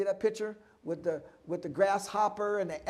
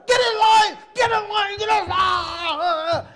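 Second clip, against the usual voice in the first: shouted high and loud; a loud, high mock yell at a class; yelling at kids; 11 semitones higher, 11 dB louder, slower than usual. Shown as a demonstration. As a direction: bark it at the kids to line up. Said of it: "so you don't yell at 'em".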